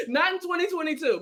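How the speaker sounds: chuckling through the words; laughing. Real, not acted. Joking with the host about quick money, laughing. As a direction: let the laugh spill into the line.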